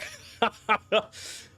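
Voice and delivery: a high, joyful laugh while hugging; happy laugh. Acted. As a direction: laugh with delight at seeing your boy. Father laughs hugging son.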